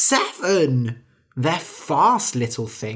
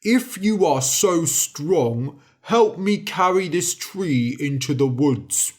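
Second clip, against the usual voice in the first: giant voice. A narrator's reading. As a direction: challenge him like a giant.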